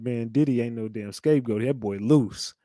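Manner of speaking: normal talking voice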